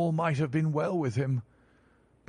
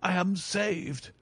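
higher, slow, drawn-out joy; overjoyed relief; 6 semitones higher, slower than usual. Acted. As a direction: savour every word of rescue. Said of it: "With a shriek of joy".

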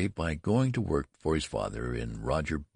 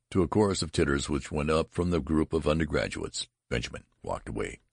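usual speaking voice

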